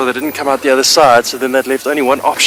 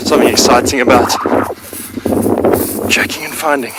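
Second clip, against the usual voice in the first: out of breath. Real, not acted. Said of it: "Sorry, I'm out of breath".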